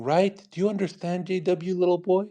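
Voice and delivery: mock-sweet talking down to a child; condescending, babying. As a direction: pat him on the head with your voice. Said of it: "you feel like I'm petting your head and going".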